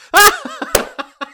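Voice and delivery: sudden, very high, loud burst of laughter; incredulous laugh. Real, not acted. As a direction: laugh out in disbelief. Laughs at absurd idea.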